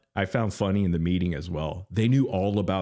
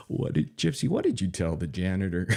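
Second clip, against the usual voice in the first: ordinary talk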